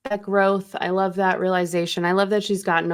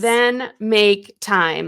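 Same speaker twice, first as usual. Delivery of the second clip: very slow, louder, word-by-word insistence; acted firm demand; 5 dB louder, much slower than usual. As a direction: hammer out each word slowly as a demand. Repeats the daughter's demand for effect.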